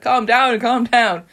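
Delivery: mock-urgent calming, both phrases pushed; urging calm. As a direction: play someone settling a panicker. Voicing Ed soothing panicking man.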